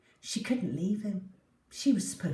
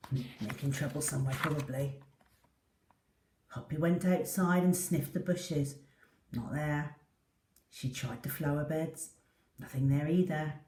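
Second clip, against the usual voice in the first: usual speaking voice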